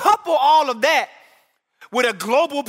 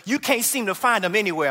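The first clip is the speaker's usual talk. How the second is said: usual speaking voice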